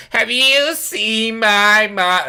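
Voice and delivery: high, slow, loud put-on nasal-free Fran voice, the girl asking for her mom; Fran Drescher impression. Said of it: "she is going to sound like Fran from The Nanny".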